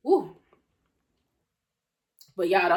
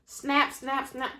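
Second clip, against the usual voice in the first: ordinary delivery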